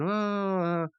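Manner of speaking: high put-on laugh; mock a cartoon character cracking up; imitated laughing. Rigby laughing at his own joke, mock laugh. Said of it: "he starts laughing".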